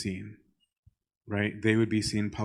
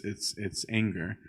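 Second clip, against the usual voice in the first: usual speaking voice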